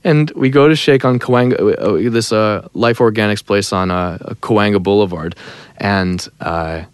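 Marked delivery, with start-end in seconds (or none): none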